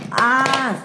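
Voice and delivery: high, drawn-out "ah"; cry of alarm. Acted. Thomas as he is shunted over the edge, cry of alarm. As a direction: let out a long high cry.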